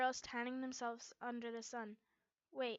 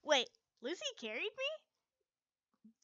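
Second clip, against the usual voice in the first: slow, high, startled; disbelieving, drawn out; surprised; 6 semitones higher, 6 dB louder, much slower than usual. Acted. Shocked at the news.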